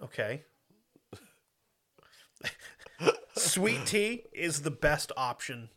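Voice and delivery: usual speaking voice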